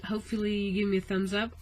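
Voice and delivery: normal talking voice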